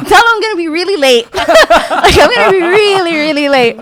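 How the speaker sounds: loud, high, fast words through laughter; laughing mock fretting. Crash victim worried about lateness, loud high laughing wail. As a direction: crack up while fretting in a high voice. Retells absurd worry, laughing.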